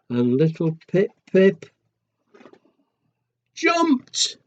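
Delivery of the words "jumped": high, bursting cry of the leap; excited jump. Acted. Narrator cries out as Pip-Pip leaps in. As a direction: burst up on the big moment.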